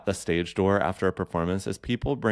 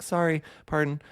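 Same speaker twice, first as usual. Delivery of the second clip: polite woman; 8 semitones higher than usual. Retold or imitated. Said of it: "she was like".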